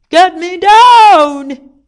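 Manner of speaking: very loud, high, drawn-out yell; desperate shout. A narrator's reading. King stuck up high demands rescue, shouting. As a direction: holler it, stretching the last word. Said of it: "he shouted".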